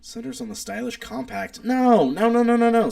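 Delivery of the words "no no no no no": loud, high run of no's at the screen; loud protest. Real, not acted. Rejecting the wrong search result on screen, loud high no's. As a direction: shout it down, no no no, louder and higher.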